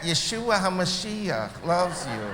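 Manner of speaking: drawn-out imitation of a Nanny-style talker; Jerry Feldman. Speaker mimics his Messianic friend who talks like the Nanny, slow impression. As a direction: stretch it out slowly, mimicking a sitcom Nanny's way of talking. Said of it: "He talks just like that".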